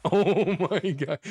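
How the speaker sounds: slow, laughing oh my God. Real, not acted. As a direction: laugh it out in disbelief. Laughs after near miss.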